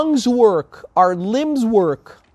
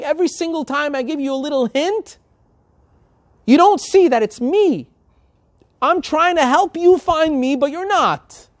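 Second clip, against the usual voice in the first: higher-pitched, impassioned plea; pleading; 7 semitones higher than usual. Acted. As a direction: plead urgently to be found. God pleading to be found.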